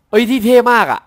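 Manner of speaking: loud, high burst of real admiration; thrilled fan exclamation; excited raving. Real, not acted. Raving about the skin's looks.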